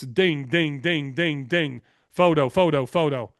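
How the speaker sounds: evenly repeated, each word dropping in tune; imitated phone alerts. Host retelling guest's barrage of texts, imitated alerts. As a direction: rattle them off like incoming messages.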